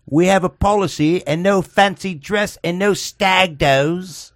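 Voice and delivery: high, slow put-on British accent; bad English accent. Pub doorman turning away the priests, in a mock English accent. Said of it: "worst, uh, English accent ever".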